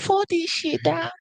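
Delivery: very high mock complaint; high upset voice. Mocking his co-host when his team loses, high upset voice. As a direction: imitate an upset sports fan in falsetto. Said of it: "my voice can get high when I'm upset".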